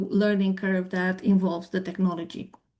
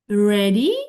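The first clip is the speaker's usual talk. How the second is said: "Ready?" rising sharply at the end; a questioning upturn on the word; 5 dB louder than usual. Shown as a demonstration. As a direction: make it sound like a question. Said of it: "you see my voice going up".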